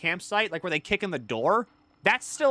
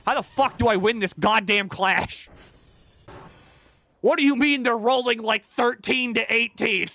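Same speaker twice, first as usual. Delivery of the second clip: mock exasperated outburst, higher and louder; frustrated gamer; 6 semitones higher, 4 dB louder than usual. Player fuming at a clash roll, mock exasperation. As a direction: vent at the unfair clash roll. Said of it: "you're mainly thinking".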